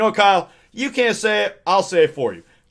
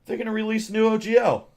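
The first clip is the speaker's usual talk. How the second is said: much higher, chirpy announcing voice; mock memo voice; 9 semitones higher than usual. Memo announcing the new OGL to Kyle, high and chirpy. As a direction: read the memo in a high cheery tone. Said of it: "he gets a memo saying".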